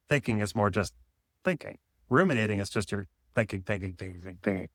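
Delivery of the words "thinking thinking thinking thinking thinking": fading mumble. Pretend. Fading repetition of rumination.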